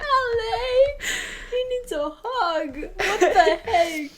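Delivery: high, wailing pity through laughter; really choked-up sympathy; tearing up. Real, not acted. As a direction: whimper it, half laughing. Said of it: "You're, like, tearing up".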